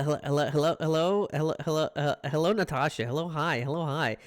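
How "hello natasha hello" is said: ordinary delivery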